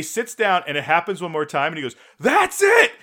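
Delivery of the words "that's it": high, loud explosive shout; mock furious scream. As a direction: imitate a drunk who has had enough. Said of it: "And he starts screaming".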